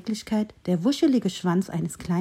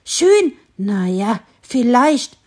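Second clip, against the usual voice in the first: grudging squirrel; 3 semitones higher, 7 dB louder, much slower than usual. A narrator's reading. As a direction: sniff then grudgingly give in. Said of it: "gab das Eichhörnchen zu". (widerwillig zugebendes Eichhörnchen)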